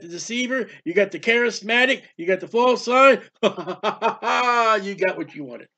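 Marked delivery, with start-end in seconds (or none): 3.4-5.7 slow, mocking laugh through the line; drawn-out sardonic laugh. Real, not acted. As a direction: chuckle it out like a told-you-so. Repeated rebuke with laugh.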